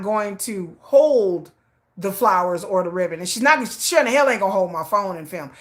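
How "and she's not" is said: ordinary delivery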